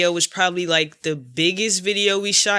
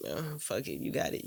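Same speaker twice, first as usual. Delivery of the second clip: much softer, lower, barely voiced; resigned giving in; 5 semitones lower, 16 dB quieter than usual. Telling TC he can keep the beanie, resigned. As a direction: let it go with a sigh.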